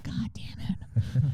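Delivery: slow, hushed, voiceless curse; exasperated muttering. Retold or imitated. Wrestler learning their entrance is second, muttered exasperation. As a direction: curse slowly under your breath.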